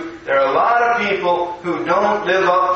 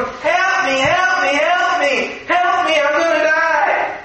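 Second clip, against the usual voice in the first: high-pitched mock cries for help; panicked child; 9 semitones higher than usual. Little boy stuck in the stream begs the big boys, mock panic. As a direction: wail like a little boy who thinks he's doomed. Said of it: "because he was scared".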